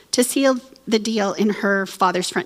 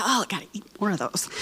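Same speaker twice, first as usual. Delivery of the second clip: hushed greedy aside; 9 dB quieter, faster than usual. Retold or imitated. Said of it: "I just go".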